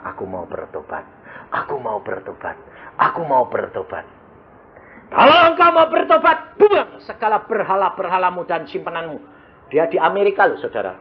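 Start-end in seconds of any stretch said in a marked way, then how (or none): 0.0-4.0 quiet plea. Retold or imitated. Penyakit memohon bertobat. 5.1-9.2 high, distant voice answering from above; voice of God. Retold or imitated. Tuhan menjawab orang sakit.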